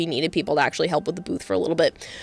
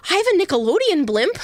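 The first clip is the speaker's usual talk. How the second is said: excited retelling; 9 semitones higher, faster than usual. Retold or imitated. Actor excited about SpongeBob.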